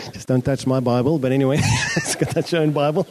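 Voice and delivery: joking aside that breaks into laughter; real laugh bubbling up through the words. Real, not acted. Pastor joking with congregation about touching his Bible, laughing aside.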